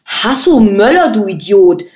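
sharp, loud rebuke; snapping at him. A narrator's reading. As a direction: bite his head off for not knowing. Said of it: "fuhr ihn Birovka an".

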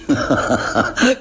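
a quick amused laugh; a light chuckle at a friend; short laugh. A narrator's reading. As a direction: laugh it off kindly. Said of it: "Erkan lachte".

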